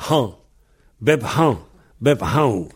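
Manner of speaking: "Han" and "Bep han" with a blown H; H prononcé. Shown as a demonstration. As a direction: breathe out the H each time in "Bep han". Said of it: "Tous les autres prononcent le H". (« Bep han » en soufflant bien le H)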